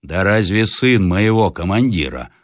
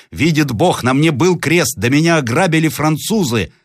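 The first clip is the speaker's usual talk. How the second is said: higher-pitched, urgent self-defence; earnest protest; 9 semitones higher, 3 dB louder than usual. Acted. Captive swearing to peasants he was robbed, earnest protest. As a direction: swear it, desperate to be believed. Captive pleads for his life.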